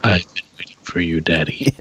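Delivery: put-on low, spooky monotone child; haunting flat ghost-girl voice. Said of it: "more spooky and monotone".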